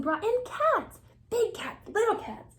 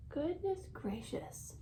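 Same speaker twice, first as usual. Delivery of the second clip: whispered aside; 13 dB quieter than usual. Acted. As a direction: whisper in amused wonder. Aside to the listening child.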